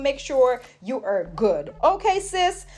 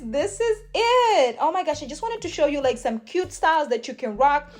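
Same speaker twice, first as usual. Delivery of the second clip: ordinary talk, nothing marked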